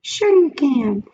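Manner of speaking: high, loud tempting little voice; pigtail voice. A narrator's reading. The pigtail coaxes Paul in a high little voice. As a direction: coax him like a tiny tempter. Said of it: "said the pigtail".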